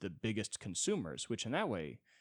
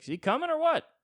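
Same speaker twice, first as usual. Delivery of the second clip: very high, loud pleading dog; whiny dog voice; 12 semitones higher, 9 dB louder than usual. Acted. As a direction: beg to go back to the guy. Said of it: "the dog's like".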